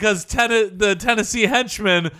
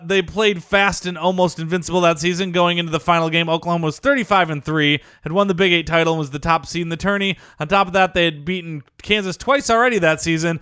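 documentary narration; 4 semitones lower than usual. Acted. Reading list narration.

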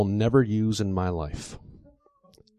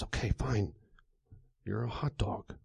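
very soft, furtive surrender; hushed, dropping to a whisper; reluctant giving in; 2 semitones lower, 14 dB quieter than usual. A narrator's reading. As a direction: say it so nobody else hears. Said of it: "He looks around and he says".

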